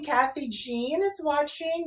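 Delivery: ordinary delivery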